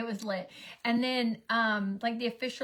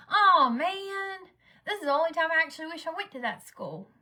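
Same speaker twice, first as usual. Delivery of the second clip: higher, louder whiny envy; envious daughter; 7 semitones higher, 5 dB louder than usual. Retold or imitated. Daughter wishing she went to that school, lightly whiny. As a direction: play the jealous teen sister.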